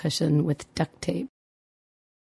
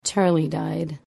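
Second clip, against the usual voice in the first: trembling; slower than usual. A narrator's reading. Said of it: "And Ben said tremblingly".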